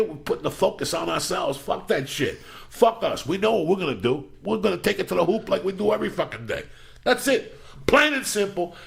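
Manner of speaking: high, pumped-up motivational preaching; pep talk rant. Acted. Host rallying listeners for Monday, high pumped-up pep talk. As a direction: hype the listeners up for the week.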